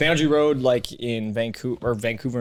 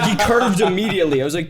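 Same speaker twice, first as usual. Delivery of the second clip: ordinary talk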